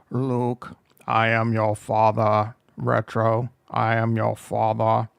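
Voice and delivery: low, slow, loud Vader voice; a Vader that drifts toward Sean Connery; Darth Vader impression. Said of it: "The Darth Vader impression sounded like Sean Connery".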